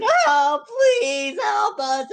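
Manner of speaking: high, loud, slow wailing plea; loud grovel. Shown as a demonstration. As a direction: wail the plea out loud and drawn out. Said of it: "So rather than".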